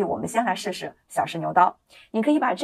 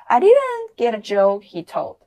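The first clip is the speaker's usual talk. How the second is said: slow, louder drill line; slow repeat-after-me; 4 semitones higher, 5 dB louder, slower than usual. Shown as a demonstration. Teacher leads students in repeating, slow and loud. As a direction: lead the class through it slowly. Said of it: "跟老师再来一遍".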